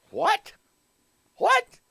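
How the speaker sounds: very high, short mock-shocked questions; incredulous. Imagined churchgoer reacting to the sermon, incredulous. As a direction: squeak out an imitated double-take, pitch leaping up.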